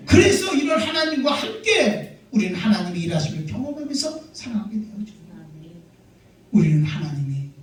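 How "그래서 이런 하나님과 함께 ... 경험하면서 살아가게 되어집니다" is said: high, forceful pulpit delivery; rally the congregation with a raised voice; impassioned preacher's proclamation; fervent preaching. Real, not acted.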